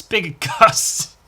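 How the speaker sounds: laughing through the words; amused laughing. Real, not acted. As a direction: crack up at your own slip. Laughing at his own slip.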